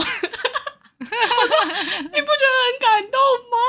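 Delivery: high, laughing plea; laughing, teary quote. Retold or imitated. Answering her boyfriend about crying at Annabelle, laughing high. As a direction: plead through laughter and tears. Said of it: "他说，呃，你哭什么？".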